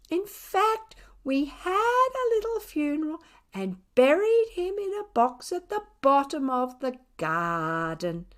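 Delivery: high sing-song neighbourly telling; gossipy neighbour. A narrator's reading. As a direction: tell it like juicy gossip over the fence. Neighbour tells Thumper story.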